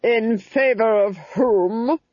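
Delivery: haughty Duchess, rising icily; icy. A narrator's reading. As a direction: draw out each word with cold disdain.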